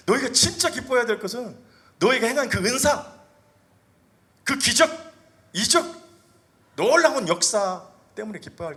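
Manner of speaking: high, loud, impassioned sermon; preaching at full force. Acted. Pastor urges the congregation, impassioned loud preaching. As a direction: drive the point home, ringing out.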